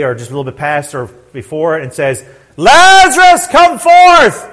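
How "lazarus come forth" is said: high, loud summons; ringing cry to raise the dead; commanding shout. Acted. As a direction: call into the tomb at full voice. Acts Jesus calling the dead.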